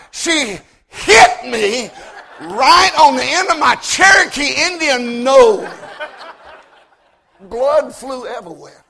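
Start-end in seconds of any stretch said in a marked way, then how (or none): none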